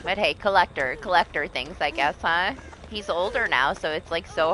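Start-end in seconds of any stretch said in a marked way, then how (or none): none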